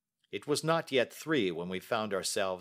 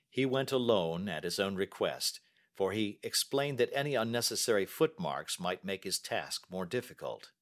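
usual speaking voice

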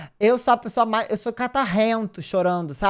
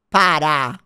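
loud protest; 8 dB louder, much slower than usual. Real, not acted. Telling chat to stop.

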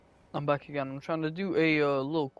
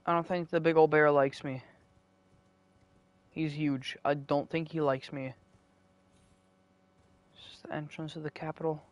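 ordinary talk

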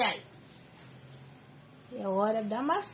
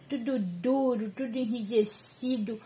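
usual speaking voice

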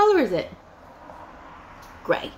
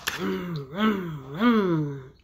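low, falling revs; car engine revs; 5 semitones lower, much slower than usual. Pretend. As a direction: rumble like a toy car. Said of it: "My gray cars".

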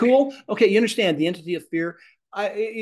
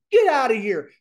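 higher, louder get-out order; loud command; 7 semitones higher, 8 dB louder than usual. Retold or imitated. Person full of faith ordering fear out, loud. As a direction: throw it out with faith. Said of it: "They're standing there with faith going".

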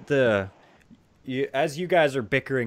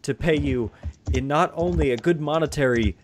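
grateful townsperson; 3 semitones higher than usual. Acted. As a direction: gush thanks, then offer formally. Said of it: "and he goes".

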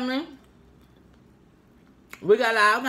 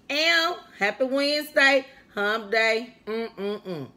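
usual speaking voice

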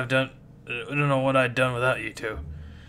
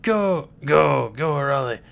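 ordinary delivery

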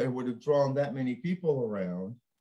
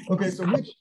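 ordinary talk

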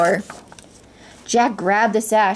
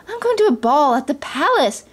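higher, gleeful announcement; excited cry; 6 semitones higher than usual. A narrator's reading. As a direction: share thrilling news with friends. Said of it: "she cried".

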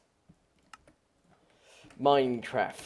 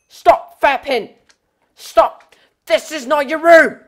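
higher and louder, shooing an intruder out; mock scolding shout; 10 semitones higher, 10 dB louder than usual. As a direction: scold it out of your room, hammed up. Shouting at a mob in house.

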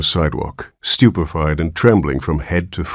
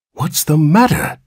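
high, slow anxious demand; appalled father demands an answer; 10 semitones higher than usual. A narrator's reading. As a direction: ask in dismay. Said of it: "demanded Mister Button, appalled".

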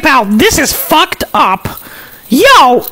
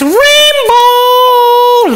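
drawn-out guess; 4 semitones higher, 3 dB louder than usual. Acted.

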